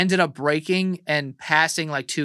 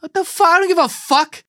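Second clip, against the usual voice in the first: outraged; 5 dB louder than usual. Real, not acted. Outraged reaction to story.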